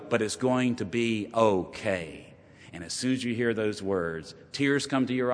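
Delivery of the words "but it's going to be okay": soft, slow and reassuring; quietly reassuring doctor with good news. Retold or imitated. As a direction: ease the family's fear gently.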